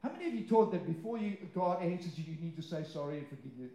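usual speaking voice

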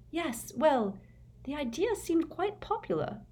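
put-on high, bright girlish voice; cheerful, a little sheepish; happy Hermione. A narrator's reading. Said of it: "said Hermione happily".

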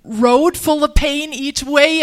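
a retold inner cry of frustration, slow and strained; frustrated. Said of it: "I remember being so frustrated".

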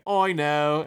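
loud mock British accent. Imitating the singer who stoked the listener, British accent. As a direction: put on a quick British exclamation. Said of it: "I heard her accent".

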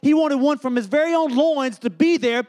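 rising, insistent sermon cadence; building preaching. Real, not acted. Preaching builds through repeated phrases.

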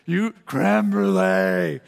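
"creme brulee" drawn out slowly, savoured like a blissful moment; mock rapture. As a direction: parody people swooning over "creme brulee". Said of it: "they always say it just like that".

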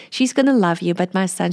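normal talking voice

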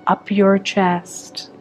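calm meditation guide with long pauses; unhurried, measured visualization voice; guided meditation. Acted. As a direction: lead them into relaxation, phrase by phrase.

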